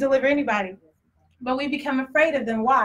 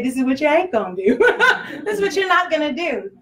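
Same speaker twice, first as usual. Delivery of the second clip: laughing; 3 semitones higher than usual. Retold or imitated. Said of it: "just say".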